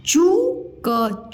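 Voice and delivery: चूक split, high rising चू, then क; sounded out slowly. Shown as a demonstration. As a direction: sound out चूक in two slow pieces. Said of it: "मैं अलग-अलग बताया करूँ". (चूक को अलग-अलग तोड़कर, धीरे)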